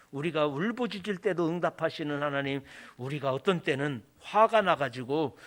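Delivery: ordinary talk, nothing marked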